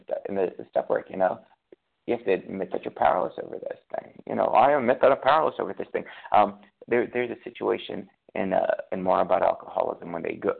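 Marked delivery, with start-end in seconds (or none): 4.5-6.0 firm recital. Pretend. At a meeting, voicing the step admission, firm and louder. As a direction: recite it louder and faster, firmly owning it.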